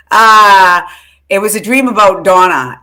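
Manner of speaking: ordinary talk, nothing marked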